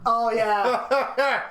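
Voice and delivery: hammed-up tough-guy Goldberg; Goldberg impression. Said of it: "He did the Goldberg".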